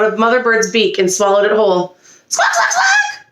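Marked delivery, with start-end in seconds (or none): 2.3-3.3 very high, shrieking bird squawks; scolding squawk. A narrator's reading. Mother Bird screeches at the duckling for stealing the worm, squawking. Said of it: "she scolded".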